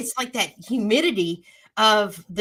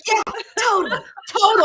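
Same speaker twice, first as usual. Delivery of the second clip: excited; 13 semitones higher, 5 dB louder than usual. Real, not acted. Excited agreement with a shout.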